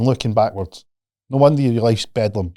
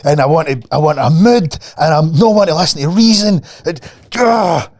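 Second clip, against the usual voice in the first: higher, louder hammed-up sulky child; a parent mimicking a furious child, louder and higher; mock angry kid; 5 semitones higher, 6 dB louder than usual. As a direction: play a stroppy kid who refuses to hear reason. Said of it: "They're f-, angry".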